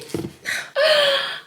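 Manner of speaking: high sudden gasp; a quick startled intake of breath; sharp gasp. Real, not acted. To viewers while doing her hair, a high gasp.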